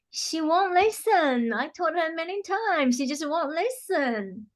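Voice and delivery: high, drawn-out voice of a complaining client; exasperated complaint. Retold or imitated. Voicing clients' frequent complaint in sessions.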